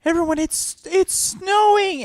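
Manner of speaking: very high, slow call; yelled announcement. Retold or imitated. Actor yelling off stage to stall, high excited call. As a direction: shout it out with stagey wonder. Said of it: "he yells off stage".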